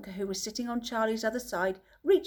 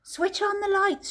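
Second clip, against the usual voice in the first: shouting; 10 semitones higher than usual. A narrator's reading. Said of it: "shouted Mister Wonka".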